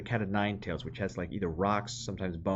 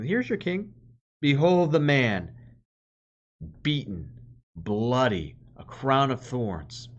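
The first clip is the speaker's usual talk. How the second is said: mock presentation; 4 semitones higher, 5 dB louder than usual. Preacher voices Pilate mocking.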